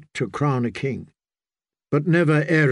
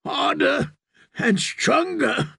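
put-on high, strained boy fighting for each word; pained, effortful speech; 13 semitones higher, slower than usual. A narrator's reading. Newly drowned boy finishes the priest's rite, high and strained. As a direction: push it out high and painfully. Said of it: "Every word was bought with pain".

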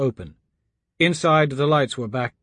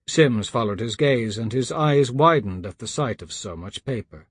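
ordinary delivery

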